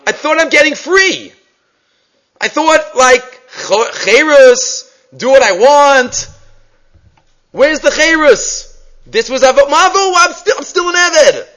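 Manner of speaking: high, indignant whining complaint; rebellious son. Retold or imitated. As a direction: play the bratty son who feels cheated. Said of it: "the second word that he's expressing is".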